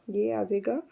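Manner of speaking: ordinary talk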